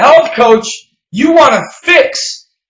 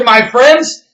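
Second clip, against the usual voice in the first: usual speaking voice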